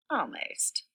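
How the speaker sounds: breath-only almost; whispered punchline. Acted. Teacher whispering the punchline to the children. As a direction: whisper it as a sly aside.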